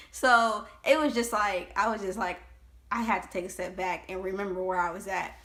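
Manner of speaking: ordinary talk, nothing marked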